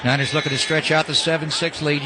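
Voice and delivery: ordinary talk, nothing marked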